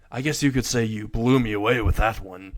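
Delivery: plain reading voice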